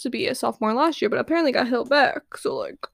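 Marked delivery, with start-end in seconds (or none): 2.4-2.9 hushed, conspiratorial aside; gossip whisper. Real, not acted. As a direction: drop to a secretive whisper. Gossip aside, spilling the tea.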